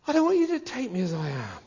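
usual speaking voice

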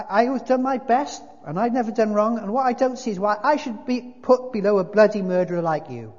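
loud, high-pitched protest; self-righteous big ghost, indignant. A narrator's reading. Ghost defending his life to the spirit, loudly indignant. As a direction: insist on your good record, hard and loud.

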